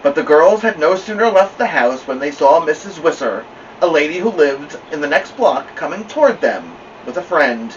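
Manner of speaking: usual speaking voice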